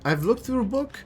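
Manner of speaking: put-on high, reassuring foreign accent; squeaky hammed-up accent; [name]'s horrible accent. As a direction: soothe them in a silly high-pitched accent. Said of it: "horrible accent of [name]".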